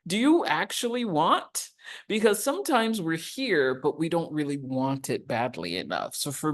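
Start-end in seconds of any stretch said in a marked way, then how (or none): 0.1-1.7 high, emphatic pleading question; Christ's question. Retold or imitated. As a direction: ask it like Jesus calling him out. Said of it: "the question that Christ asked".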